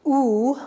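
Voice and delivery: "oo" held long, as in moon; long vowel sound. Shown as a demonstration. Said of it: "when we say oo, like, say the word moon".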